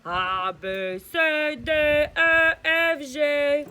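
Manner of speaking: belching the letters; burped alphabet. Acted. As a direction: burp out the alphabet. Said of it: "il fait l'alphabet".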